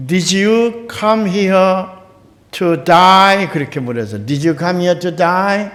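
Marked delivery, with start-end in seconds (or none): 0.1-3.5 put-on slow drawn-out Australian accent, today heard as to die. Taxi driver asks the American tourist, in an Australian accent. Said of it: "영국식 발음을 하지요". 4.2-5.7 put-on slow drawn-out Australian accent, today heard as to die. Taxi driver asks the American tourist, in an Australian accent. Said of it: "영국식 발음을 하지요".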